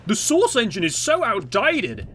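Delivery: high, whiny put-on complaint; mocking naysayers. Imitating people who knock Source engine, high mocking whine. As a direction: mimic a know-nothing critic up high. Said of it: "the people that always try and say".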